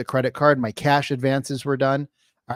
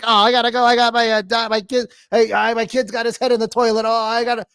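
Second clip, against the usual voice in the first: high, hurried prospect desperate to hang up; flustered excuse-maker; 8 semitones higher, 4 dB louder than usual. Acted. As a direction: scramble for excuses to escape the call. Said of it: "They come up with the greatest excuses".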